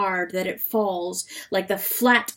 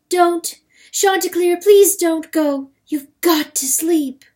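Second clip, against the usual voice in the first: high, loud, urgent plea; desperate raised-voice begging; pleading; 9 semitones higher, 8 dB louder than usual. A narrator's reading. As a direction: beg loudly, pitch way up. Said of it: "Perlote was pleading".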